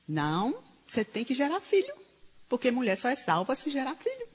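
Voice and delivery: usual speaking voice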